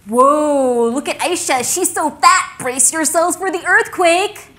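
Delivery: loud, high-pitched mocking shout; jeering school kids. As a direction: holler it like a playground taunt, voice climbing. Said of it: "the kids at school used to say I was fat".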